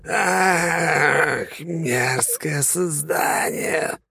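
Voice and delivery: high, slow pained demon cry; moaning monster. Acted. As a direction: groan it out like a wounded creature. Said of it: "простонала тварь".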